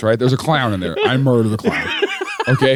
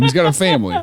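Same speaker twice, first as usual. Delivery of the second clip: normal talking voice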